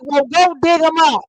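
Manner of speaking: high, loud mock comeback; retold comeback. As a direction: fire off the quoted punchline, loud and high. Teacher's comeback in retold quarrel.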